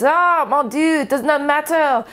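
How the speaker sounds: high-pitched put-on French accent; bad French accent. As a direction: play a nonchalant Frenchman with a hammy French accent. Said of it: "that was my French accent".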